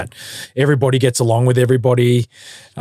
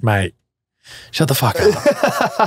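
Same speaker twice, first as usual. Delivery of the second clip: mock Aussie accent; 4 semitones higher, slower than usual. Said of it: "and you're just like".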